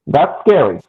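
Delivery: usual speaking voice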